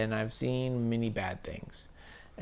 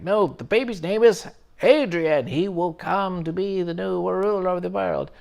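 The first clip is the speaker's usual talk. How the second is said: put-on higher, louder cult voice proclaiming the baby's destiny; imitating the coven; 7 semitones higher, 6 dB louder than usual. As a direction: announce the new ruler like a fanatic witch. Coven answers the mother.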